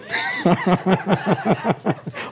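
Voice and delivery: long rolling chuckle; amused laugh. Real, not acted. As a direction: laugh at your own joke. Joke about soaking in the anointing.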